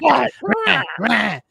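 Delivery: mocking how an actress yells, high and ratty; raspy ratty yell. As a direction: imitate a raspy actress yelling in a movie. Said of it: "ratty voice".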